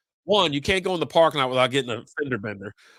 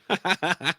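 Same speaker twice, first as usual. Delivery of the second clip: short, higher-pitched laugh; 5 semitones higher than usual. Real, not acted. Podcaster amused after the Bears aside, laughing. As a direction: let out a quick run of laughter.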